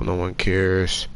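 normal talking voice

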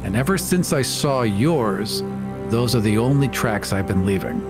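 ordinary talk

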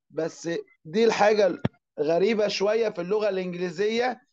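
ordinary talk, nothing marked

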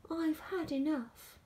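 high, fed-up complaint; exasperated cry. A narrator's reading. George throws himself down and gives up, fed-up cry. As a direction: cry out, worn out. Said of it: "he cried".

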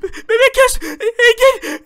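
usual speaking voice

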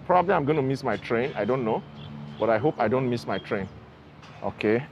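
muffled behind surgical mask; through a face mask. Real, not acted. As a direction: mask over mouth and nose. Seen 0: surgical mask covers mouth and nose throughout labelled words.

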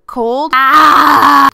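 loud mock scream on cold; imitated scream. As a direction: shout it like the upset rider. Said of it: "she would scream".

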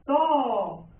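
a long, held TO; "To" said alone, vowel prolonged; stressed syllable drawn out. Shown as a demonstration. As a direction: stretch the accented O in To. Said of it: "Lleva acento en la O".

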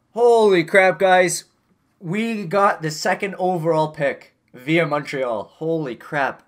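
thrilled by lottery luck; excited surprise. Real, not acted. Gamer reacting to winning the draft lottery, loud excited. Wins second overall pick.

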